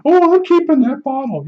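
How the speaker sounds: high falsetto, smug and chirpy; neighbor woman voice. Retold or imitated. Neighbor telling me she keeps my bottle, high mock falsetto. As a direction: chirp it like a pleased old lady.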